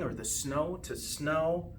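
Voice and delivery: ordinary delivery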